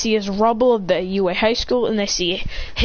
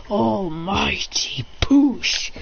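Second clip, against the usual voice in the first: whispered technique call; much slower than usual. Retold or imitated. Hero kicks All Might aside, slow whispered call. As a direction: whisper the move name very slowly. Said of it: "He goes".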